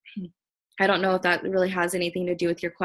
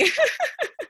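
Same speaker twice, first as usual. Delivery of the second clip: high teary laugh breaking out; a high, teary little laugh. Real, not acted. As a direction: laugh through tears. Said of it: "Stop crying".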